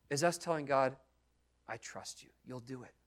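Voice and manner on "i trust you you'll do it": whispered, soft confession of trust; soft whisper of faith; hushed trust. Retold or imitated. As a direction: breathe it quietly to God. Intimate prayer of trust.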